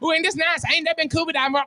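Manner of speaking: normal talking voice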